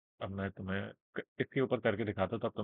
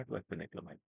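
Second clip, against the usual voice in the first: softer, lower throwaway aside; quiet aside; 3 semitones lower, 8 dB quieter, faster than usual. Real, not acted. Teacher tells viewers to watch at 2x, soft aside. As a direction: drop it under your breath to the viewer. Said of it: "बहुत आराम-आराम से बोलूँगा".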